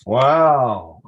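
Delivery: loud, long-drawn wow; amazed. Real, not acted. Coach reacts to the finished story, loudly amazed. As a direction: let one big wow swell and fall.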